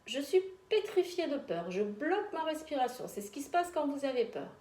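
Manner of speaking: tense, held-breath fearful voice; frozen with fear. Shown as a demonstration. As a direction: show being petrified with fear. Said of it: "Je vous montre une fois".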